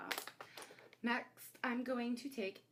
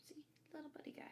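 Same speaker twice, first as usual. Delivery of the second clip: cooing whisper; 8 semitones higher, 13 dB quieter, much faster than usual. Real, not acted. Talking to the tiny brush.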